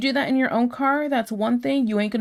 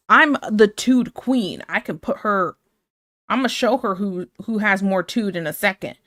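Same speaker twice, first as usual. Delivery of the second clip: ordinary delivery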